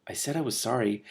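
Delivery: ordinary delivery, nothing marked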